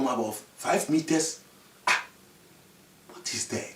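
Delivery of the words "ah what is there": hushed wondering. Acted. Chief whispers from the car.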